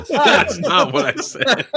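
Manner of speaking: laughing protest, high and loud. Real, not acted. Laughing protest at being teased.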